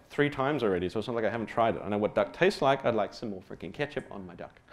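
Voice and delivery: normal talking voice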